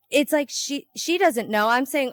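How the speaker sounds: ordinary talk, nothing marked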